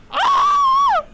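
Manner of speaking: shrill, very high shriek; real shriek of fright; terrified scream. Real, not acted. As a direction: scream in pure panic. Monster appears on screen.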